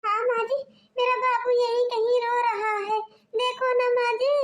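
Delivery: put-on mother begging mother-in-law to find her crying baby; tearful pleading. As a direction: beg her to look, close to tears.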